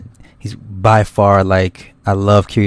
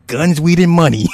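higher, on the edge of laughter; amused, laughing; 9 semitones higher than usual. Real, not acted. Host joking about Ryan's best life, amused and laughing. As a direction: say it while starting to crack up. Cracking up over Ryan's lifestyle.

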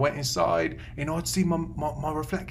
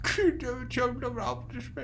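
high, slow, soft struggle; worsened, garbled attempt; 8 semitones higher, 5 dB quieter, slower than usual. Client fails to repeat the doctor's phrase, high and garbled. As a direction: strain through it, mangling the words slowly. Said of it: "I think you've gotten worse".

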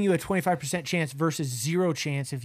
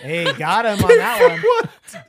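laughing outcry; 12 semitones higher, 8 dB louder, slower than usual. Real, not acted. Outcry at silly question.

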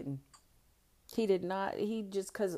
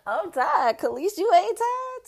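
loud, high mock whine; hammed-up high-pitched complaint; exhausted partner; 12 dB louder, slower than usual. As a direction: wail it like a worn-out lover. Imitates worn-out partner begging off.

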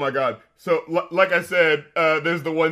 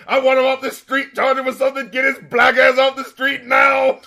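higher, louder furious shouting order; furious Hoover; 10 semitones higher, 7 dB louder than usual. Retold or imitated. Hoover raging at his agents, yelling the order to get him off the street. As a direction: bellow it like an enraged boss demanding an arrest. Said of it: "you see Hoover fucking angry as balls".

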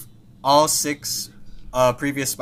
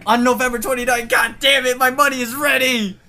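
hyped shouting; 9 semitones higher, 4 dB louder than usual. Real, not acted. Hyped about ticket date.

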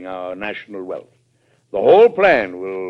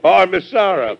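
laughing fondly; 9 semitones higher, 8 dB louder than usual. Acted. President laughing at his secretary's dry reply, amused. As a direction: laugh breaking into a louder, higher Oh, Miss Sarah.

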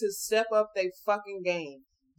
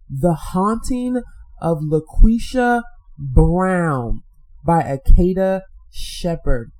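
slow announcement; 2 semitones lower, 10 dB louder, much slower than usual. Shown as a demonstration. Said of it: "One more time".

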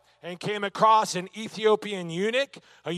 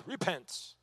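put-on quiet, lower-pitched English accent; 3 semitones lower, 13 dB quieter than usual. Preacher imitating a British Army command, English accent. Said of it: "in their awesome English accent".

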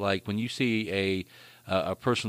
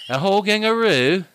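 mock English accent, higher and louder; parroting the accent slip back at him; imitating a slipped accent; 10 semitones higher, 7 dB louder than usual. Friend mimics his accidental English accent, higher and louder. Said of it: "you went".

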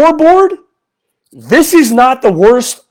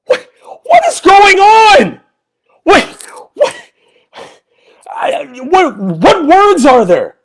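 exasperated shout; 8 semitones higher than usual. Real, not acted. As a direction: yell it in sheer frustration. Angry post-game rant.

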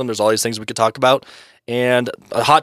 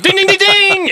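bell ringing; 5 dB louder than usual. Pretend. Game show correct-answer bell.

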